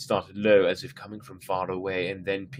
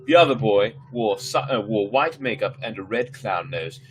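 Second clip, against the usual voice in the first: ordinary delivery, nothing marked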